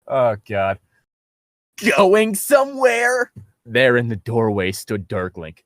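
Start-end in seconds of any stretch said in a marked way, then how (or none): 2.0-3.3 slow, higher, hammed-up villain taunt; mock villain voice. Reading Darklink's taunt to the hosts, hammed-up slow villain voice. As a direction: ham up a cheesy baddie catching them.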